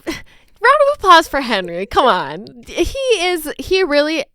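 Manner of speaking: normal talking voice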